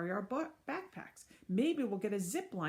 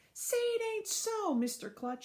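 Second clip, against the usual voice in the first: shouted; 13 semitones higher than usual. Acted. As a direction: wail it like a let-down kid. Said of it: "shouted one of the kids".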